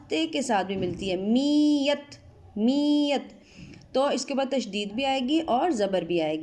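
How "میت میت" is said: میت with the doubled یہ held, slow and higher; show the doubled sound. Shown as a demonstration. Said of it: "یہ کی آواز میم کے ساتھ".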